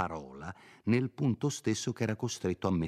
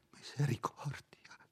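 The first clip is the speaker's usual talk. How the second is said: breathless whispered cry; faint gasp; 7 semitones higher, 6 dB quieter than usual. A narrator's reading. Frightened priest whispers his plea to Renzo. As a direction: gasp it out faintly, barely voiced. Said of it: "esclamò con voce fioca".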